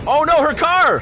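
high, loud cry of alarm; alarmed shout. Real, not acted. Viewer watching a woman's car roll off, alarmed shout. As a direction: yell out in real shock. Reacting to runaway car in clip.

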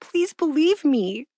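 high, drawn-out imitation of pleading; mock begging. User begging the chatbot to believe them, mock pleading. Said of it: "This person's begging".

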